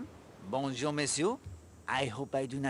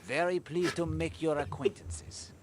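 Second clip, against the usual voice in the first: whisper; 2 semitones higher than usual. Acted. Poirot greets couple.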